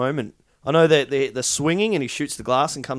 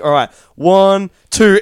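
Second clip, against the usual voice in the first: loud, drawn-out retold count; called-out countdown; 4 semitones higher, 10 dB louder, slower than usual. Film crew counting down to a stunt drop, called out loud. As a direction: count it out big, building to the drop. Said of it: "they're like".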